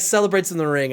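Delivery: ordinary delivery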